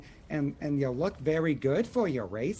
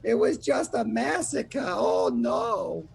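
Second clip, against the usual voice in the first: distraught gnome; 8 semitones higher, slower than usual. Acted. Bloodied gnome telling the party about the massacre, distraught. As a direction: wail it out, shaken and distraught. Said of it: "there is Billy who is there, just like".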